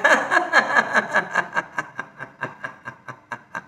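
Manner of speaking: long rhythmic laugh, fading away; released laughter. Shown as a demonstration. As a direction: let the breath out as a long staccato laugh. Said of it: "पुन्हा श्वास घ्या". (श्वास सोडत लांब हास्य)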